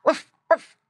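high, loud imitated oinks; two short, high piggy grunts. A narrator's reading. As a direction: grunt like a little pig. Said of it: "grunted Squinty".

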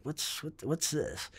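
soft, quiet puzzled question; hushed wondering. Retold or imitated. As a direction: ask it under your breath. Startled inner reaction to crowd.

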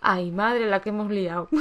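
laughing at the chaos she caused; laughing exclamation. Real, not acted. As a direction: cry out while cracking up.

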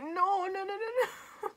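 high-pitched comic wail; exaggerated tearful pleading; mock crying. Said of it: "I was genuinely crying".

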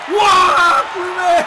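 loud high cheer at a goal; excited yell. Real, not acted. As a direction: cheer the striker's name. Goal, streamer yells. (골에 흥분한 높은 환호)

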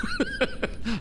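very high squealing laugh; real squeal of laughter; giggling fit; squeaky laugh. Real, not acted. Laughing at the timeline.

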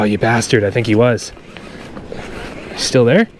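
usual speaking voice

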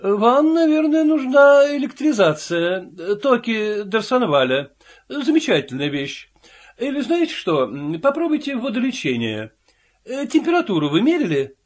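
a chatty doctor advising a patient, lightly voiced. A narrator's reading.

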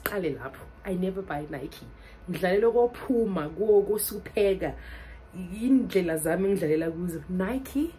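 ordinary talk

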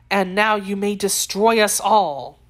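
usual speaking voice

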